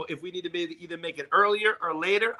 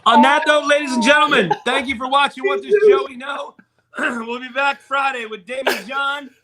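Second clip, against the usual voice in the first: much louder, higher announcer voice; booming show sign-off; 7 semitones higher, 6 dB louder than usual. Acted. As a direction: close the show like a host.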